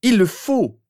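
loud, high, desperate exclamation; outburst. A narrator's reading. Harry startling his sister with desperate outburst. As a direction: burst out insistently, high and loud. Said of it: "étonnée par cet éclat de voix".

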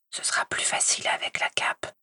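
soft, breathy whisper; hint at a secret under your breath; whispered conspiratorial aside; murmured. Acted. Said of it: "murmura-t-il".

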